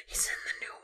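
awed whisper. Real, not acted. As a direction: breathe it in disbelief. Overcome by cast news.

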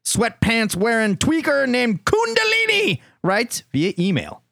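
high, drawn-out buildup to the name; hammed-up show host intro; hype announcer. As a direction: announce the contestant with mock grandeur. Hyped introduction of the letter writer.

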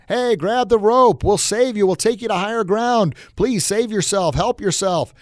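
acted megaphone-style plea, pitched well up; yelling down. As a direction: call down urgently to someone stranded below. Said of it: "they yell down with the microphone".

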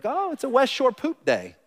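high, playful mock announcement of poop day. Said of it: "We're like".

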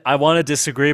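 ordinary talk, nothing marked

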